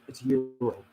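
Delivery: "euro" for gyro, no G sound; silent G joke. Shown as a demonstration. Reading chat's silent-G joke, "euro". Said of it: "The G is silent".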